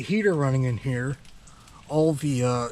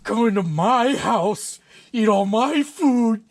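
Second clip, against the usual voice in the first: slow, louder and higher Scottish outrage; indignant Scottish accent; 8 semitones higher, 5 dB louder, much slower than usual. Acted. The Scotsman rages at the homeowner who called him names, slow Scottish outrage. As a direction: drag out each word in outraged Scottish. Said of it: "doing that Scottish accent".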